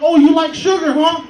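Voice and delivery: loud, high menacing taunt; loud mock taunt. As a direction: sneer it back loudly at the kid. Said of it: "And I'm like".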